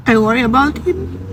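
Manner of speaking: high, tearful confession; emotional worry. Real, not acted. Mother confides she worries about her son, close to tears. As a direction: let real worry lift and tighten the voice. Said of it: "I'm gonna be emotional again".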